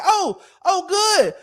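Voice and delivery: sing-song sarcastic dare; mocking sarcasm. Taunting the wife who called the sheriff, high mock-cheerful. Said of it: "I said".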